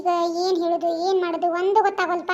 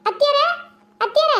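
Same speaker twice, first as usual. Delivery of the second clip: high, louder call with rising end; raised, lilting summons; 7 semitones higher, 3 dB louder than usual. Acted. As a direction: call for someone in another room. Calling out for mother-in-law.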